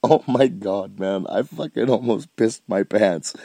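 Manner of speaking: ordinary talk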